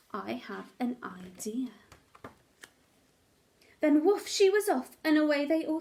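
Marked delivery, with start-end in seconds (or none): 0.1-1.7 slow secret whisper. A narrator's reading. Dog sharing a secret plan with Peter, whispered slow. The bark meant, secret idea.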